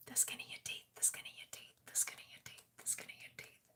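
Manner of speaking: fading repeated ASMR whisper; trigger word whisper. Shown as a demonstration. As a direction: soften each repeat to nothing. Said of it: "Isn't that relaxing?".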